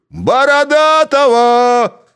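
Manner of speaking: very high, loud, slow yell; shout. A narrator's reading. A Cossack shouting for Borodaty, loud. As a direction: call out the name.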